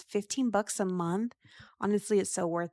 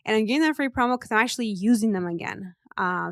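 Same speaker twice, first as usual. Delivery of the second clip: normal talking voice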